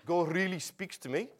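put-on Afrikaans accent, pushed high. Shown as a demonstration. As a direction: exaggerate a South African accent. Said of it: "that's a really Afrikaans accent".

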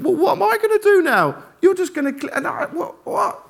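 high, loud mock-flustered protest; bewildered abandoned dad. Dad left in the boat by his sons, mock-bewildered. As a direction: play a dad left stranded, spluttering. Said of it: "his dad was left in the boat thinking".

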